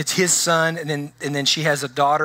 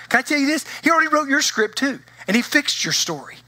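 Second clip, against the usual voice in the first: excited preaching; 9 semitones higher than usual. Real, not acted. Pastor springs the big reveal on his congregation, excited and high. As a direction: pitch jumps high, lifting the punchline.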